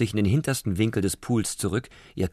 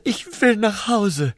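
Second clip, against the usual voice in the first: higher and slow, whining plea; drawn-out whine; 12 semitones higher, 4 dB louder, slower than usual. Acted. Prawn begging to go home, slow high whine. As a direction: stretch it into a homesick whine. Repeated slower whining take.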